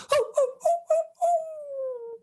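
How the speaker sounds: very high hooting, last one drawn out; eerie high-pitched hoots; strange forest hooting. Acted. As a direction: hoot like a night creature in dark woods. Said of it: "There were strange sounds all around".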